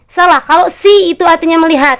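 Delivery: normal talking voice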